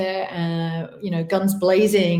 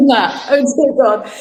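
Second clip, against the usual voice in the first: higher, louder, laughing it out; overwhelmed; 4 semitones higher, 8 dB louder than usual. Real, not acted. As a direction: gasp it, moved and laughing. Overwhelmed by praise.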